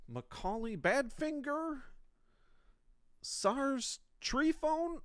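high, silly announcer voice; mock name caller. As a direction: call the fake names out in a high, goofy voice. Reading fake names from bucket.

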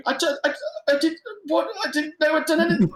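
high, fast stammering, acted out; panicked pleading. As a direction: plead innocence in a mock-terrified squeal. Imitates terrified taxi driver pleading.

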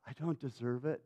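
sudden soft, low confession; subdued, unworthy murmur; humbled admission. Acted. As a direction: drop to a small, quiet voice. Peter's plea collapses into humility.

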